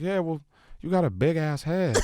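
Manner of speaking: high put-on rapper voice. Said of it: "And he's like".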